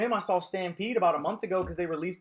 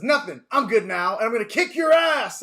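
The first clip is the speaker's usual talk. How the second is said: cocky Luffy; 4 semitones higher, 8 dB louder than usual. Retold or imitated. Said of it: "He goes".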